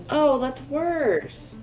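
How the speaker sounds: ordinary delivery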